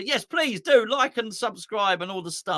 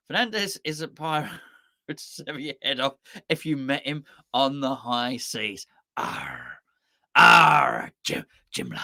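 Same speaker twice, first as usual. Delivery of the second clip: growled low pirate voice; slow pirate growl with a drawn-out "arr"; 4 semitones lower, 3 dB louder than usual. Acted. Conjuring up Fernandes as a pirate.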